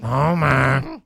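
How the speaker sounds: a touch of a slow groaning Marge; lightly voiced murmuring wife; Marge impression. Trying the wife's voice for his cohost, slow murmur.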